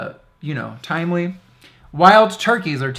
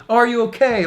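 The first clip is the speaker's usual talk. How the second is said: higher, concerned attendant checking in; worried attendant; 9 semitones higher, 6 dB louder, faster than usual. Retold or imitated. Gym attendant worried about the customer, a light concerned voice. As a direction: ask like a nervous gym attendant. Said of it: "He's like".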